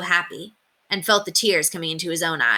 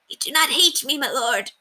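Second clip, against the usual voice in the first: a hint of a tearful old woman, higher; 8 semitones higher than usual. A narrator's reading. Calendar to Sir Simon, lightly voiced relieved tearful cry. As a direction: plead in relieved disbelief. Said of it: "she cried".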